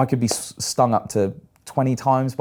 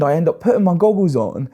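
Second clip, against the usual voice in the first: ordinary delivery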